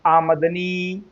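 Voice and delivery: आमदनी slowly as आ-मद-नी, vowel after म; clear pronunciation. Shown as a demonstration. Teacher asking for clear reading, आमदनी slowly as आमद-नी. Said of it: "आप पढ़िए साफ साफ".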